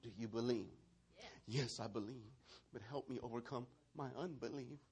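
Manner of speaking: low, very soft question and answer; hushed. Acted. Preacher replays Jesus asking and the father answering, hushed. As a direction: drop right down to a quiet, intimate murmur. Same exchange repeated hushed.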